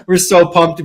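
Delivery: ordinary talk, nothing marked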